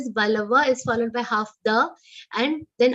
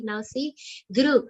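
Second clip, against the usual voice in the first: usual speaking voice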